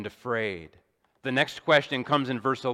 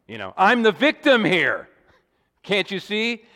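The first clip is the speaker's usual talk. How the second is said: mock victim; 6 semitones higher, 6 dB louder than usual. Preacher mocks Adam's self-pity.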